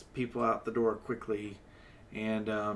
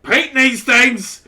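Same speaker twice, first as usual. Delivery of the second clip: loud, higher, building to a sputtering threat; drunken rage; 10 semitones higher, 17 dB louder than usual. Acted. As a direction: rage until the words fail you. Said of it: "And says".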